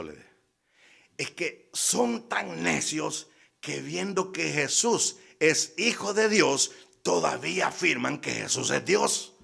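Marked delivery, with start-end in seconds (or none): 1.2-9.3 slow, drawn-out, higher-pitched reproach; emphatic scolding. Retold or imitated. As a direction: stretch it out insistently, pitched up. Retold insult from a haughty opponent.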